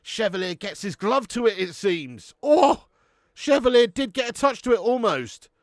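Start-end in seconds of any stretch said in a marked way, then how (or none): 2.4-2.9 sudden loud oh, pitched high; real shock at the keeper's touch; surprised cry. Real, not acted. Penalty nearly saved live. 3.3-5.5 excited. Real, not acted. Excited reaction to near save.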